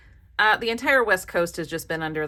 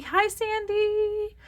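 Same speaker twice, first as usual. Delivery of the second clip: high, drawn-out hello; sing-song greeting; 13 semitones higher, much slower than usual. Real, not acted. Crafter calling hello to a generous subscriber, sing-song greeting.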